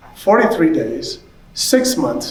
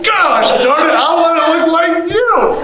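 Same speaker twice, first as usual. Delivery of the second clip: laughing, much higher comic shout; playful outburst of frustration; shouted whine, half laughing; mock protest; 12 semitones higher than usual. Told he looks like dad.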